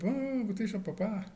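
put-on high, loud child's call. A narrator's reading. Girl calls out to her papa, high and loud. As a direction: call out to papa while skating up. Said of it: "kwam aanglijden en riep".

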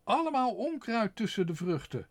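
high, loud sneering question; scornful mistress. A narrator's reading. Mistress scorning the father's words.

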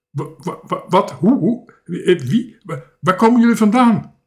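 high, halting, then loud and quick; stunned stammering. A narrator's reading. Castaway seeing four children on animals, stammering in astonishment. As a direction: stumble over each question in astonishment. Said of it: "bracht hij schor uit".

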